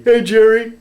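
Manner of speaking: loud, high teasing call of the nickname. Pretend. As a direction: needle him playfully with the nickname. Teasing Jeremiah with hated nickname.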